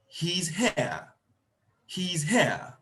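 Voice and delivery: HERE said as a statement, pitch falling at the end; falling tune on HERE; statement intonation. Shown as a demonstration. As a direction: let HERE drop firmly, stating a fact. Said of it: "Mine is a statement".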